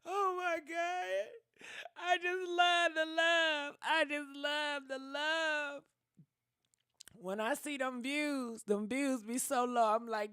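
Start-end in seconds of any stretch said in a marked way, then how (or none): 0.0-1.3 high, crying, voice breaking; overwhelmed with tears, thanking everyone; tearful thanks. Real, not acted. Emotional thanks to donors, crying. 2.0-5.8 choked up, high and breaking; moved to tears; tearful. Real, not acted. Overcome, repeating through tears.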